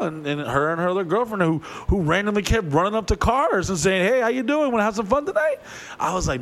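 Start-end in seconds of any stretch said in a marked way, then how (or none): 4.1-5.6 high-pitched mock come-on. A girl calling to cars on the street, pitched high. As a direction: sing it out to passing cars.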